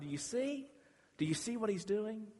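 ordinary delivery

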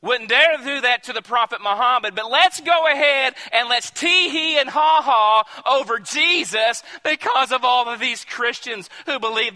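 loud, high fervent outcry; a real indignant preaching peak. Real, not acted. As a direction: rail against the mockers from the pulpit.

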